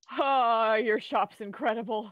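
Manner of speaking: loud wide-eyed wonder at the shop; loud awe. Acted. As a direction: gush over the shop. Player character impressed.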